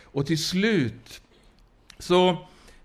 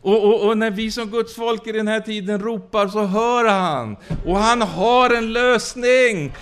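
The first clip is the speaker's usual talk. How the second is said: preaching at full force; 7 semitones higher, 4 dB louder than usual. Acted. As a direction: preach it out, drawing the key lines long. Preacher rising to emphasis.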